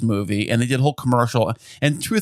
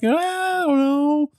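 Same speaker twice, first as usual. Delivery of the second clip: high, hesitant "ah, I don't know"; mock hesitant wife; slower than usual. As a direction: imitate her reluctance, pitched way up. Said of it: "And you're like".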